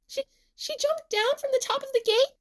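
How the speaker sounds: high, stammering shriek without extra volume; shocked scream. A narrator's reading. Nene seeing Emu leap from the gate, shocked scream. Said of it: "screams Nene".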